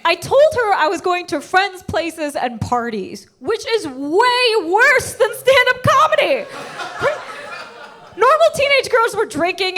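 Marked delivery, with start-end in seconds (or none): 3.4-9.8 incredulous outburst. Acted. Comic arguing against mom's ban, exasperated outburst. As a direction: stretch it out in loud disbelief.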